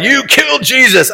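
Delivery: high, sharp accusation thrown at the crowd; mock accusing tone. Pastor mimics a mean reading of Peter, accusing. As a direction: imitate a harsh condemning preacher. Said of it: "it's easy for me to start going".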